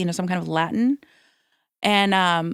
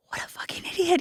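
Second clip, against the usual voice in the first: much softer self-scolding aside; muttered to herself; 9 semitones higher, 7 dB quieter than usual. Retold or imitated. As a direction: mutter it at yourself, embarrassed. Retold muttered self-insult.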